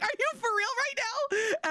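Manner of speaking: high, slow, drawn-out disbelief; mock shocked squeak; incredulous. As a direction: stretch it out, stunned she'd do that. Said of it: "And I was like".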